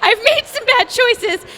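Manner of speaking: high, loud desperate pleading; panicked plea. Retold or imitated. Clinging to SWAT officer, panicked high plea. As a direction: beg for rescue, half crying. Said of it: "grab onto his vest".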